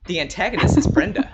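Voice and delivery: announced loud and high like a big reveal; dramatic reveal. Real, not acted. Co-host revealing his answer to Megan, loud and triumphant. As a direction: proudly unveil the answer.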